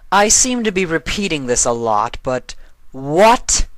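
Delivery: a goddess losing patience; Nightmare Moon's voice. A narrator's reading. Nightmare Moon leers at Discord, irritated.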